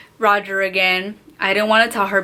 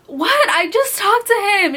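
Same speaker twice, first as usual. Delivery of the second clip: high-pitched retold protest; defensive protest; 11 semitones higher than usual. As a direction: protest innocence to a scolding cousin. Defends herself to cousin, retold.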